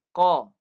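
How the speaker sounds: Qa said sharply from the back of the tongue, not nasal; a crisp, loud Qa in the mouth; clear qaf. Shown as a demonstration. Teacher shows the clear Qa, no nasal sound. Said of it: "Qaf baris atas".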